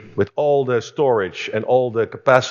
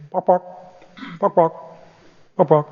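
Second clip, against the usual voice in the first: playful clucking noises, slightly higher; mock chicken clucking; 6 semitones higher than usual. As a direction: cluck like a hen to amuse the crowd. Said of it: "talk like a chicken".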